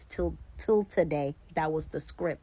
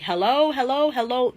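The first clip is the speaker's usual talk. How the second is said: higher, louder calls into an empty hall; calling out; 7 semitones higher, 5 dB louder than usual. Retold or imitated. Alone in the airport, calling for anyone. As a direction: call out hello down a big empty corridor.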